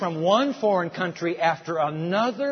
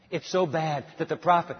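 ordinary talk, nothing marked

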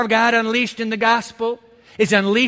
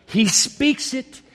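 slow refrain; 4 semitones higher, much slower than usual. Acted.

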